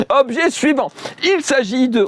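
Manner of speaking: a squeaky high game-show announce; mock high voice. Shown as a demonstration. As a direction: pipe it up high like a joke.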